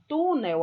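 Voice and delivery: "túnel" with the TU drawn out and punched; stress on first syllable. Shown as a demonstration. Teacher showing students the stressed syllable in "túnel", stress on TU. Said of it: "observa que tu é a sílaba tônica".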